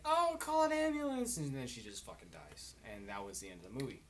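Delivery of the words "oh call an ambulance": mock panic. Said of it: "And everybody's like".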